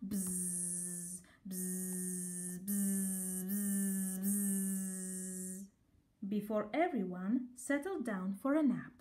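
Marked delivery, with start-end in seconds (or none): 0.1-5.6 soft low buzzing bee sounds. A narrator's reading. Norman practising his buzzing, soft low bzz. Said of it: "buzzing practice".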